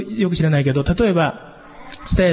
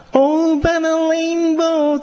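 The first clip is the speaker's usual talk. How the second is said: synth-like voice; 12 semitones higher, much slower than usual. Retold or imitated. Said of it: "シンセみたいな声でやる".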